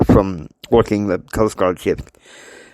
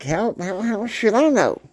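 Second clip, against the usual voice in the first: much higher-pitched protest; indignant retort; 10 semitones higher than usual. Acted. Christine answering her father's demand, high indignant retort. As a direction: throw the question back in a high voice.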